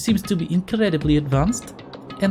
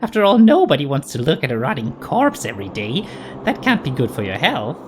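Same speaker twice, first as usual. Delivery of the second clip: lower, creepy cartoon bear; sinister mascot voice; 5 semitones lower than usual. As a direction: drop into a mock-sinister bear voice. Monokuma drops to a darker tone.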